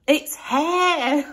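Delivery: imitating mum, high and pointed on hair; a mild mock of mum insisting on the H; mock mum correction. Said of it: "she went".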